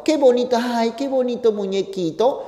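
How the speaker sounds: lightly voiced girl gushing over the toy; mild sweet girl voice; girlfriend cooing. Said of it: "y la chica dice".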